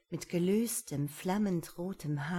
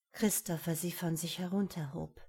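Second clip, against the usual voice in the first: usual speaking voice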